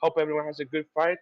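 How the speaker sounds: ordinary talk, nothing marked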